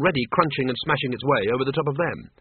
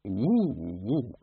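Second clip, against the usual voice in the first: two soft ape oohs; reply only in low-key hoots; ape hooting; 3 semitones lower, 5 dB quieter than usual. Acted. The librarian advises Windle, soft double hoot.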